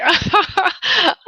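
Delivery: breaking character giggling. Real, not acted. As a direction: burst of giggles. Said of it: "I can't keep a, a straight face".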